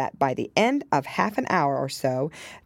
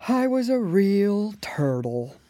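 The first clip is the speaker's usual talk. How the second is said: deep sigh; 5 semitones higher, slower than usual. A narrator's reading. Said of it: "with a deep sigh".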